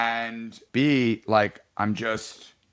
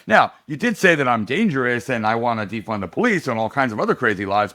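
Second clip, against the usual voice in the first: ordinary talk, nothing marked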